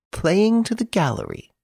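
theatrical high-pitched protest; indignant echo. Acted. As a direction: throw the phrase back at them, pitched high. Prisoner echoes the remark indignantly.

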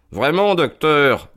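high, slow and sugary; butter him up with mock sweetness; honeyed tone. A narrator's reading. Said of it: "dit Holmes d'un ton mielleux". (ton mielleux, aigu et lent)

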